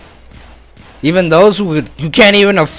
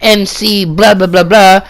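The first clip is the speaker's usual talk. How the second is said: a hint of a Puerto Rican accent on a YouTuber intro; mock Puerto Rican accent. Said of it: "with fucking little Puerto Rican accent".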